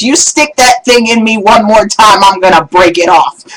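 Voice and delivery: loud, threatening shout; furious husband yells at wife; angry yell. Retold or imitated. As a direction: warn her, fed up and loud. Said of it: "and screams".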